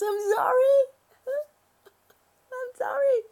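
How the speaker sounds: high, loud, laughing through a yawn; sorry squeaked high between laughs; laughing apology. Real, not acted. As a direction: apologise while giggling at yourself.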